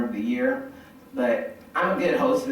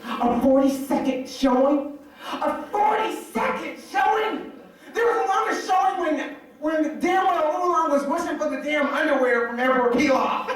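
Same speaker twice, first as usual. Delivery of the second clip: high-pitched incredulous tirade; hammed-up fanboy disbelief climbing higher; outraged fan rant. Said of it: "next thing he goes off on is the dragon".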